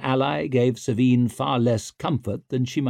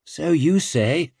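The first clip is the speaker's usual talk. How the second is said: slow, higher-pitched grunt; uncomfortable, grudging mutter; grunted aside; 6 semitones higher, slower than usual. A narrator's reading. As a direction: grunt it sceptically. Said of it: "grunted Savine".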